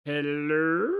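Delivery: loud, high hello with a rise; calling hello. Real, not acted. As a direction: call out hello into the void. Calling into the void.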